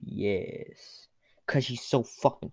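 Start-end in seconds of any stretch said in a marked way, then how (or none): 0.0-1.1 whispered yes. Acted. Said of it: "And you're like".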